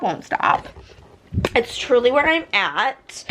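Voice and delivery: ordinary delivery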